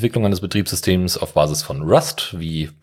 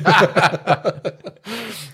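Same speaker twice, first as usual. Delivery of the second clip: high, bursting laugh; hearty laugh; 12 semitones higher than usual. Real, not acted. Laughing after a joke.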